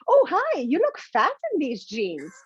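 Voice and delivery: sweetly tactless put-on greeting; mock-friendly insult. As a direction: chirp the hello, then drop the pitch for the insult.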